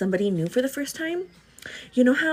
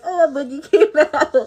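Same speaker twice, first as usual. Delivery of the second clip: put-on embarrassed kid, giggling; 4 semitones higher, 5 dB louder than usual. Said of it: "You're like".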